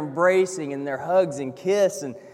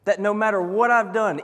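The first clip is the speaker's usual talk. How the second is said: ordinary delivery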